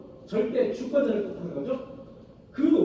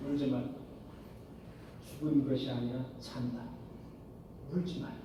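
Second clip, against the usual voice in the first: low, soft and tender, with long pauses; gentle comfort; 9 semitones lower, 9 dB quieter than usual. Acted. Jesus gently comforting Mary and Martha, low and soft. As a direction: speak as Jesus calming the weeping sisters.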